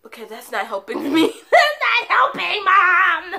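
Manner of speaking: loud, high, laughing protest at mom; laughing exasperation. Retold or imitated. To her crying mom, a loud laughing protest. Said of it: "And I'm all like".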